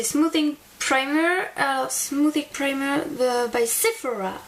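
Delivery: clumsy English accent, slowed down; english accent french. Real, not acted. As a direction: say the product name in a bad English accent. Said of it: "j'ai un accent anglais pourri".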